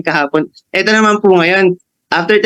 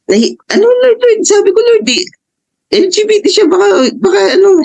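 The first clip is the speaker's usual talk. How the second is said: anxious plea. Retold or imitated. Worried plea to the Lord about calling, high anxious voice.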